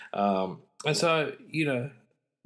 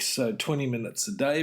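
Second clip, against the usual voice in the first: ordinary talk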